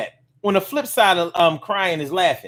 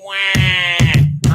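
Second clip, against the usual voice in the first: mock baby wail; 3 dB louder than usual.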